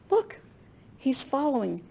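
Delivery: high excited call; excited look. Acted. Reader shows the children Red following. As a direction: point it out with delight.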